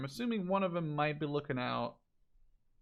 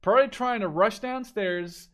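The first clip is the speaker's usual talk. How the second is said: louder, higher, building hype; rising excitement; 4 semitones higher, 9 dB louder than usual. Real, not acted. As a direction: build it up as the ambush unfolds. Excited caster as ambush nears.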